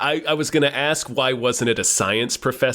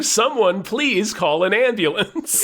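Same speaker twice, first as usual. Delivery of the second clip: high, drawn-out mock cry for help; mock scream; 6 semitones higher, slower than usual. Imagined dying man calling for help while strangling a preacher, mock scream. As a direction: plead slowly in a raised pitch, no real volume. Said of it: "strangling him while screaming".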